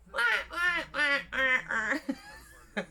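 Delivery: high, laughing "wah wah" like mocking ducks; imitating laughing ducks. Wife on the boat mimicking the ducks laughing at her. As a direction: quack like a duck laughing at you. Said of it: "They sort of go".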